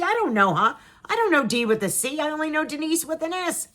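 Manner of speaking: high, insistent protest of not knowing; mock bafflement. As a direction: raise the pitch as if utterly clueless. Imagined bafflement over death plaque.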